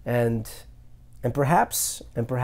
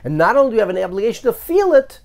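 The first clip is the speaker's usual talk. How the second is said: building intensity; 6 semitones higher, 4 dB louder than usual. Real, not acted. Building argument to listeners.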